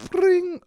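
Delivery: high-pitched mock ringtone; imitated phone ring. Comedian acting out a call on the train, imitated ring. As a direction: trill like a ringing phone.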